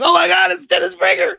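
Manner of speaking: thrilled fan's shout; starstruck gasp. Retold or imitated. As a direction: blurt it out like a fan spotting a hero. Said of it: "I heard his voice like".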